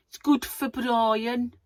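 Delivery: Birmingham accent. Shown as a demonstration. Vlogger slipping into a silly accent on camera, hammed-up Birmingham accent. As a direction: stretch it out in a broad Birmingham accent. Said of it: "Why did I say it in that accent".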